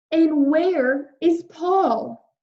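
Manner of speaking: put-on a child's worried question; worried boy.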